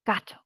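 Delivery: gato said whole after the sounds; blended word. Shown as a demonstration. Teacher blending the Spanish word, modeling. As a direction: bring the sounds together into gato. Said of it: "blend them all together".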